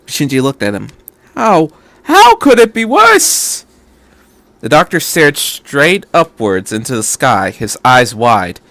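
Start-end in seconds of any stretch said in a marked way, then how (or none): 1.3-3.7 put-on high, strained boy's voice close to tears; a frightened young boy, much higher and louder, spiralling into panic; distraught teenage boy. A narrator's reading. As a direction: voice Shinji panicking over his fault. Shinji's disbelief.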